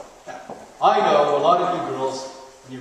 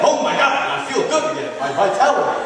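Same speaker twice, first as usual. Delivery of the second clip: revived delight; 11 semitones higher, 6 dB louder than usual. Acted. Acted revived person's joy.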